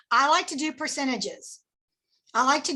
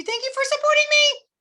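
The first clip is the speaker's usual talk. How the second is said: gushing thanks; 13 semitones higher, 6 dB louder than usual. Shown as a demonstration. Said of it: "modeling grateful thanks".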